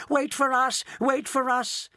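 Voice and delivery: mock shout of eager old folks. A narrator's reading. Three old people chasing Mister Wonka, a lightly shouted call.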